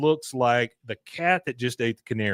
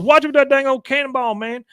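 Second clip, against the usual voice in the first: Boomhauer impression; 13 semitones higher, 4 dB louder, faster than usual. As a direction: rattle it off like Boomhauer. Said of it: "he goes".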